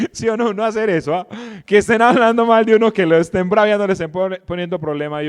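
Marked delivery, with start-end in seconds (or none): none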